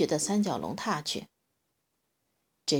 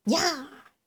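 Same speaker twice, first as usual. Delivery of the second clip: battle cry; 8 semitones higher than usual. A narrator's reading. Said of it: "然后大喝一声".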